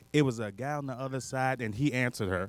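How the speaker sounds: ordinary delivery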